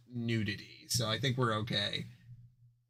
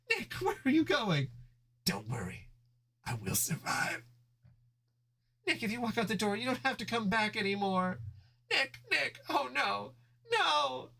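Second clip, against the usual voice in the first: put-on high, panicked girlfriend calling after him; desperate Judy; 11 semitones higher than usual. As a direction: cry out as he walks away, pitched high.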